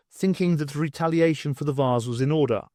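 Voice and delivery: plain reading voice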